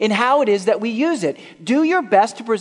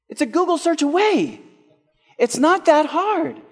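much higher, insistent; emphatic exhortation; 10 semitones higher than usual. Real, not acted. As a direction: push it up, almost pleading. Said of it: "preacher exhorting, pitch up".

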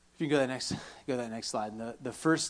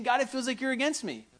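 high-pitched plea to God, acted out; retold crying out; 10 semitones higher than usual. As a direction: cry out a complaint in a raised pitch. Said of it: "she's crying out and she's saying".

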